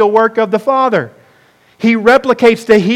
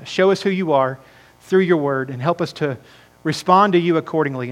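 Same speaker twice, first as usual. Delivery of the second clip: lower, subdued pastoral prayer; hushed, humble talking to God; 5 semitones lower, 4 dB quieter than usual. Real, not acted. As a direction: lead the congregation gently in prayer. Leads the congregation in prayer.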